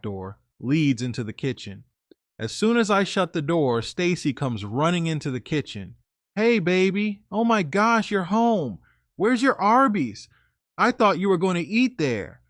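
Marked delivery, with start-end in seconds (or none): 6.3-12.4 lightly voiced girlfriend, pitched higher; a hint of a chirpy girlfriend calling hello; girlfriend's greeting. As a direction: boyfriend imitating his girlfriend greeting him at the door, pitched up a touch. Girlfriend greets him at the door.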